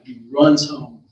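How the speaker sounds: ordinary delivery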